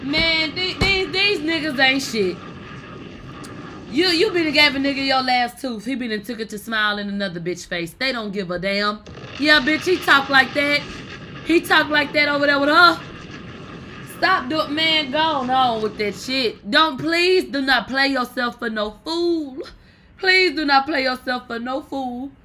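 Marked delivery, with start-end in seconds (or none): 0.0-5.9 loud, high, heated rant at cheating men; fired-up rant. Real, not acted. Vlogger scolding women who trust cheating men, loud and high. 9.4-22.3 loud scolding. Real, not acted. Vlogger warning women not to play themselves, loud scolding. Scolding viewers about the boyfriend.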